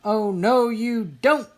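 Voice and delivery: high, loud playful shout; shouting back. A narrator's reading. Little sister shouting back at her brother in play, loud. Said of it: "Sister shouted back".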